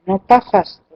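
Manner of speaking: ordinary talk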